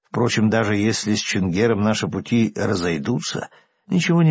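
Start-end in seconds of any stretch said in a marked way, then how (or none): none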